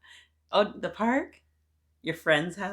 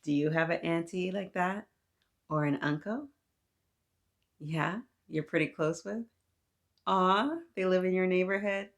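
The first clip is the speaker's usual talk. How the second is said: ordinary delivery, nothing marked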